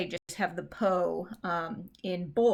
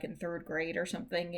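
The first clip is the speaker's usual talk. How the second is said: ordinary talk, nothing marked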